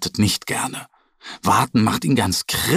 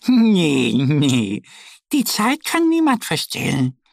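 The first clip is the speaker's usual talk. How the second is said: little duck laughing it off; 6 semitones higher than usual. Acted. Duck Annie laughs off the bear's magic tin idea, high. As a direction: brush it off merrily, way up high. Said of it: "Annie lacht".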